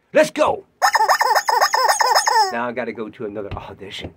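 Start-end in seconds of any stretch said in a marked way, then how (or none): 0.7-2.5 loud whooping laugh; high shouting laugh. Real, not acted.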